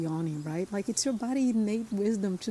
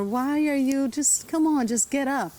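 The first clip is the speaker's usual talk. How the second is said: higher, louder urging to get up; mock exasperated parent; impatient parent; 6 semitones higher, 4 dB louder than usual. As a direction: hurry the child up, hammed. Parent hurrying a crying child.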